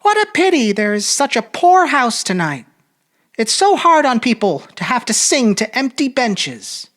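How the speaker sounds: a lady making polite small talk; woman's voice. A narrator's reading. Narrator voicing the lady chatting to her neighbour at a concert.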